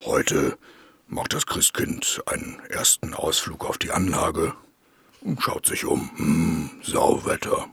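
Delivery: soft whispered storytelling; whispering. Acted. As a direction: whisper it like a secret tale. Whispered mock narration.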